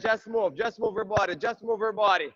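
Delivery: ordinary delivery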